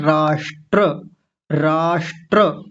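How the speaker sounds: राष्ट्र drawn out slowly; slow demo. Shown as a demonstration. Teacher modelling the next word slowly. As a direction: say राष्ट्र slowly with ष्ट्र clear. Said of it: "आगे शब्द क्या है?".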